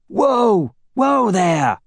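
high, slow, drawn-out shout. A narrator's reading. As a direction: halt the riders with a yell. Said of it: "someone shouted".

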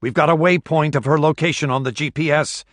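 loud call across the deck. A narrator's reading. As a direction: call it out firmly. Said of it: "Esterhazy called out".

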